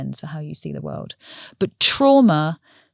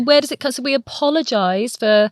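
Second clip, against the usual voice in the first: normal talking voice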